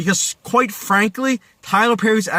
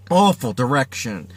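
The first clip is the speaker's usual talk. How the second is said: ordinary delivery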